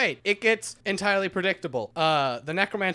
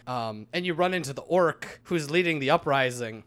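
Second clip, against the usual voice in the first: usual speaking voice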